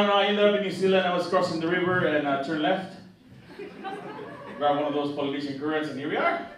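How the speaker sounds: ordinary delivery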